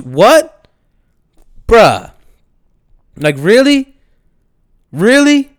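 loud, high cries of "really?"; incredulous shout. Real, not acted. Vlogger shouting in disbelief at the bus driver. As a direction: yell in stunned disbelief.

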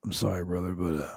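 low-pitched wrestler excusing himself; Jake the Snake Roberts. Retold or imitated. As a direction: apologize haltingly in a low voice. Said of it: "And he's like".

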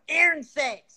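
high-pitched imitation of a friend calling out; imitated yell. Imitating a friend yelling out from the audience, high yell. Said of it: "He's yelling out".

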